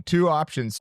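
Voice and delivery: ordinary talk, nothing marked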